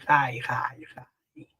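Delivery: hi whispered, breathy and unvoiced. Shown as a demonstration. Teacher showing the Hebrew sound, whispered. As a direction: breathe the word hi. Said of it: "they pronounce it as hi".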